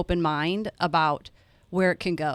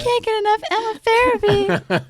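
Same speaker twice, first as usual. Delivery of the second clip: much higher, louder, hammed-up adoring fan voice; gushing mock fan; 7 dB louder than usual. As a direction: play him as a gushing, swooning fan, way up high. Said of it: "He's gonna go".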